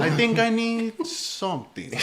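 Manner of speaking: put-on slow, high, pondering Colombian accent; Gustavo. Bandmate unsure what to eat, slow high Colombian-accented musing. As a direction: draw it out as an imitated Colombian friend. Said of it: "Gustavo's like".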